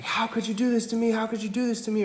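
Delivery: high, pained mock accusation; boyfriend's accusation. Enraged boyfriend grabbing her hair, high mock wail. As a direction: imitate the raging boyfriend pleading in a high voice.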